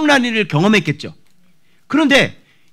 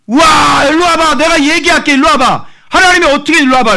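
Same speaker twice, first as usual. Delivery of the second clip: high, loud, overjoyed calling out; bursting with joy, calling people over; ecstatic shouting; 8 semitones higher, 6 dB louder than usual. Retold or imitated. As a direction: shout to everyone to come and see. Psalmist calls everyone over, overjoyed.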